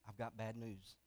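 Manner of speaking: somber husband. Retold or imitated. Husband breaking sad news to wife, low and soft. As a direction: hush it down like bad news.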